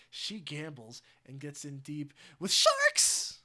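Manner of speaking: slow loud Joker outburst; loud Joker line. Acted. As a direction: bellow it slowly, unhinged. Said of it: "quote the Heath Ledger Joker".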